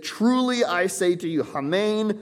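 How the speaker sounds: TRULY pitched high and stressed; emphatic statement. Shown as a demonstration. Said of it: "emphatic statement".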